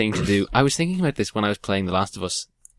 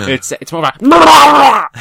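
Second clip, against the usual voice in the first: zombie groan shout; 13 dB louder, much slower than usual. Retold or imitated. Zombie noise imitation shout.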